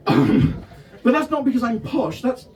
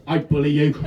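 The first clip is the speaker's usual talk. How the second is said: a light Welsh accent, mock audience member; lightly voiced Welsh heckler; 6 semitones lower than usual. Comic mimics Cardiff crowd in mild Welsh accent. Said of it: "I did that in a Welsh accent".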